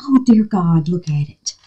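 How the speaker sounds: ordinary talk, nothing marked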